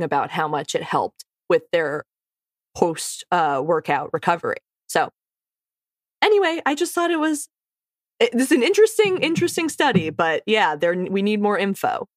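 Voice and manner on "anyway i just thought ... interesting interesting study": excited. Real, not acted. Excited high fast burst.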